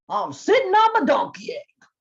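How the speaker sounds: gruff bear voice; growling bear. Acted. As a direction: grumble back at the hare. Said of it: "growled Bear".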